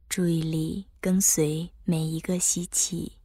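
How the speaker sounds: calm guide easing the body; guided meditation. Acted. Leads breath focus meditation.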